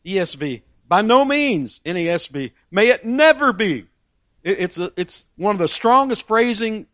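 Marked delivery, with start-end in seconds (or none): 0.9-1.8 outraged. Acted. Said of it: "carries a sense of outrage". 2.7-3.8 higher, emphatic, never stressed; outraged exclamation. Acted. Preacher quoting Paul's horrified no to the church. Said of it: "carries a sense of outrage".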